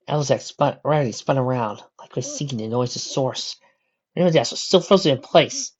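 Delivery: ordinary delivery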